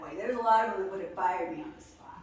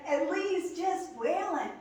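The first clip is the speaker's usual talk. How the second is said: normal talking voice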